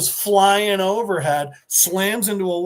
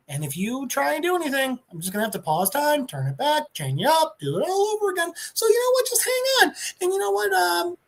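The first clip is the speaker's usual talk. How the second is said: gleeful Ghost Rider taunt; 7 semitones higher than usual. Retold or imitated. Ghost Rider taunting Thanos before dragging him off, giddy high voice. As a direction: voice climbing way higher, quick and playful. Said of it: "the Ghost Rider's like".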